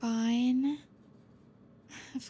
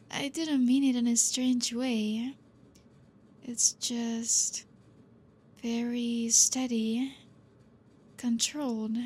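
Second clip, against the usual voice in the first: normal talking voice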